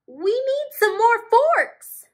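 very high, loud flurried voice; flustered storks. Acted. Storks needing forks, high flustered voice. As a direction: say it in a fluttery rush. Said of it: "flurried the storks".

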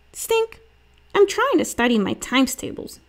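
high-pitched call, then complaint; annoyed sister. A narrator's reading. Judy to noisy brother while studying, annoyed complaint.

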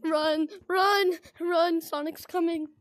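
panicked shouting, running for life; frantic yelling to flee; being chased. Real, not acted. Fleeing monster in horror game.

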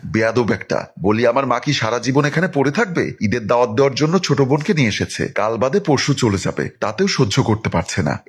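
ordinary delivery

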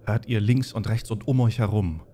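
low, hushed dungeon narration; eerie scene narration. Acted. Game master describing the undead approaching, hushed and eerie.

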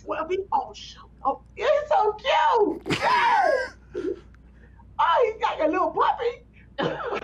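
high, slow, hammed-up wail; mock crying. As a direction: whimper as if the groom scares you. Bride mock-crying at the groom.

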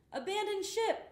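high, loud urgent call. A narrator's reading. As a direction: shout the order to leave the boat. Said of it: "called Pete".

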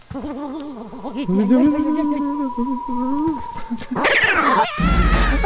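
a high, delighted squeal of laughter. Real, not acted. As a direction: squeal with glee at the cute character. Laughs watching Luigi.

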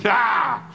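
sudden high indignant "Ah!"; sharp cry of outrage. Acted. Killer cutting off the woman with an outraged cry. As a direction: bark out a startled, offended exclamation. Villain interrupted mid-argument.

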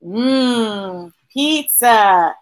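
usual speaking voice

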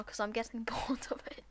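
hushed whispered aside; whispering. Real, not acted. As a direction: whisper it with a laugh. Unvoiced aside.

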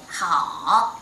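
好 drawn out, down then up; dipping third tone. Shown as a demonstration. Teacher repeating the third tone example, falling-rising 好. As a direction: let 好 sink low and climb back. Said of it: "Down first and then up".

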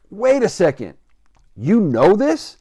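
loud, high, drawn-out disbelief; can't believe his friend knows it; surprised. Retold or imitated. On the phone to Craig, stunned he knows the line.

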